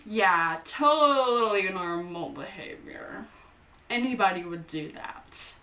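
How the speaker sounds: usual speaking voice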